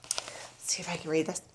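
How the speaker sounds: ordinary delivery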